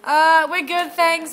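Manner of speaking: politely but nervously wave him off; a nervous high-pitched "we're good, thanks"; awkward refusal. Retold or imitated. Awkward refusal acted in story.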